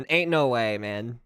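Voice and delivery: normal talking voice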